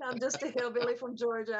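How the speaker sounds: laughing through the line. Real, not acted. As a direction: chuckle it out, echoing your friend. Echoing friend's hillbilly line, laughing.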